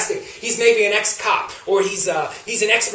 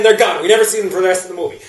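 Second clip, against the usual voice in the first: ordinary talk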